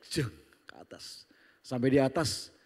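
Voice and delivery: usual speaking voice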